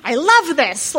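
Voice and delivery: a hint of an over-the-moon fan; gushing friend. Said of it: "he was like".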